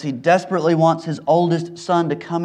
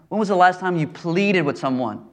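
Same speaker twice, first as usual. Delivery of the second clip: ordinary talk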